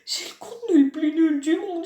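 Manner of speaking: high, hammed-up wail; mock wailing. YouTuber declares own content worst, mock wailing. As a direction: wail it out in fake despair. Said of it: "J'ai envie de pleurer".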